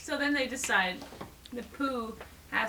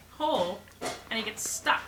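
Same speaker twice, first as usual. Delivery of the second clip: usual speaking voice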